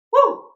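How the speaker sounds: loud high whoop of joy; celebratory woo. Real, not acted. Vlogger cheering Penn State's win, a loud high whoop. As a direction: let out a big victory whoop.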